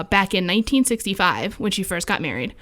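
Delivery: ordinary talk, nothing marked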